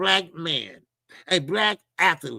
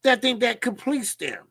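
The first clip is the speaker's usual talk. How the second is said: ordinary delivery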